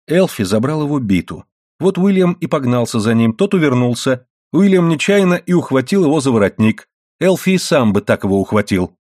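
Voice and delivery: plain reading voice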